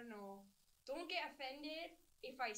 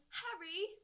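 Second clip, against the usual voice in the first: put-on breathy British girl, pitched up and loud; Hermione Granger; 12 semitones higher, 19 dB louder, slower than usual. Showing friends her impression, breathy British cry. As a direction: call out in urgent breathy British. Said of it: "doing my Hermione Granger impression".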